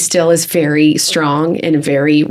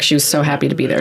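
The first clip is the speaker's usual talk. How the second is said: usual speaking voice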